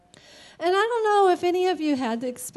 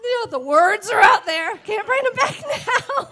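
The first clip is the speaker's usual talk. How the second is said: high, loud laughing exclaim; laughing embarrassed; 8 semitones higher, 7 dB louder than usual. Real, not acted. Telling friend what slipped out, laughing embarrassed. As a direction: laugh at her own blurted confession.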